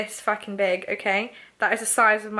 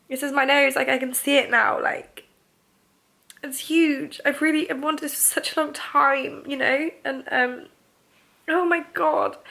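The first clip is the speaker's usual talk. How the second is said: higher, sighing, teary voice; overwhelmed, crying happily; real happy crying over her new nose; tears of joy; 4 semitones higher than usual. Real, not acted. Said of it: "These are tears of joy".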